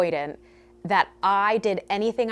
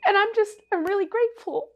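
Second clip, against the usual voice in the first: much higher, playful squeak on grateful; squeaky high voice; 12 semitones higher, faster than usual. Pretend. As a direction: pitch it way up, cutesy and teasing. Repeats gratitude in squeaky voice.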